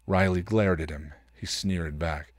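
usual speaking voice

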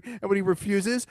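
ordinary delivery

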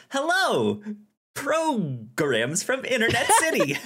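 high, cheerful host voice; a sunny, upbeat hello; bright chipper greeting. Shown as a demonstration. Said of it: "Instead of".

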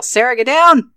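loud, high warning shout; urgent in-character yell. Acted. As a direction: yell it to make someone drop to the ground. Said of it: "I'm just gonna yell".

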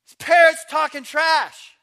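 loud, high, drawn-out tattling; hammed-up indignant yell; offended complaint. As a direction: holler the complaint in comic outrage. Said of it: "This guy's offended".

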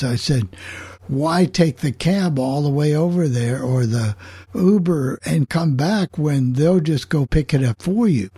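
normal talking voice

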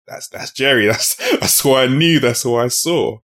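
delighted, laughing pride; proud laughing recognition. Pretend. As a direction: pretend boss laughing with joy. Imagined proud boss voiced, laughing.

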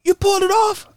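very high amazed question; shocked high squeak. Retold or imitated. Friend amazed he passed the diving course, very high. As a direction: let it jump up in shock. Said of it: "He said".

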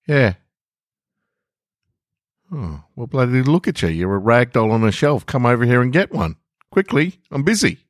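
acted story read with a small child voice and a dad voice; gentle storyteller voicing a kid and a busy dad; story narration. A narrator's reading. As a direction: read it like a little radio play. Reads a story with voiced figures.

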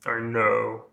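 actor impression, first attempt; Alan Rickman. Shown as a demonstration. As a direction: try the Snape actor's voice on two words. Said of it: "it was better the first time".

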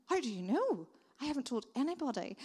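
put-on startled girl asking how he knew; surprised girl. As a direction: she can't believe he knows, blurt it. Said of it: "and she was like".

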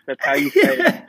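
light mock-defiant, laughing; laughing retort. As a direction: insist on it through a laugh. Defending his pronunciation, laughing.

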